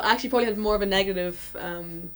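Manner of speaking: ordinary talk, nothing marked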